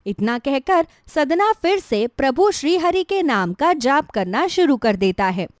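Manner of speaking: ordinary delivery, nothing marked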